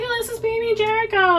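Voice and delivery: put-on high, cooing mom showing off her baby; high sing-song baby-talk mom; doting mom voice. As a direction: coo over the baby like a proud mother. Mom shows off doll as baby.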